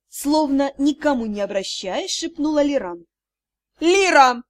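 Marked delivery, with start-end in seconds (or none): 3.8-4.4 loud furious name call; roar. Acted. Mother roars at her daughter, loud. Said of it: "взревела леди Тьер".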